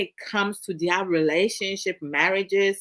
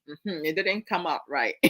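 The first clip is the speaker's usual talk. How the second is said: normal talking voice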